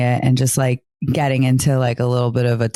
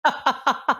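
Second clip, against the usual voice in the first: laughing; 9 semitones higher, slower than usual. Real, not acted. Laughing pirate joke.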